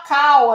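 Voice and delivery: "call" mimicked as "cow"; loud mock mistake, "call" rhyming with "now"; common mispronunciation. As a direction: drop the L in "call", gliding to W. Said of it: "muita gente fala call".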